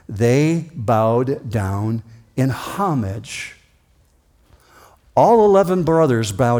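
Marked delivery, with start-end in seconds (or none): 0.1-3.5 very slow, weighty "they bowed down in homage"; slow solemn reading. Shown as a demonstration. Preacher reads the verse to the church, very slow. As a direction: draw out each word solemnly. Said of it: "Verse twenty-six and in verse twenty-eight".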